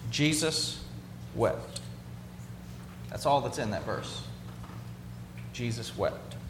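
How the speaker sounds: low, soft, pausing between the words; hushed reverence. Real, not acted. Preacher reading the shortest verse to his congregation, hushed and reverent. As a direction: let the short verse land quietly.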